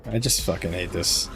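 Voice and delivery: ordinary talk, nothing marked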